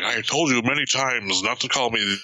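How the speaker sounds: low, stern scolding; low, stern rebuke at home; stern villain. Retold or imitated. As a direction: scold her in a deep voice.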